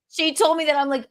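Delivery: ordinary talk, nothing marked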